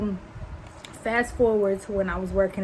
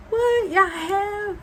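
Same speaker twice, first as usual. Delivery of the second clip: put-on shocked girlfriend; 11 semitones higher, 3 dB louder, much slower than usual. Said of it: "Sis, me and Jack".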